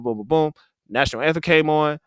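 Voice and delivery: ordinary talk, nothing marked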